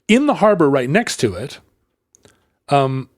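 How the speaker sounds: ordinary delivery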